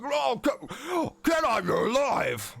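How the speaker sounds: high, shouted astonished uncle voice; Walden Crane. Acted. Uncle finding his niece alive, high astonished shout. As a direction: cry out in drawn-out amazement. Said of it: "He, he comes out of his office".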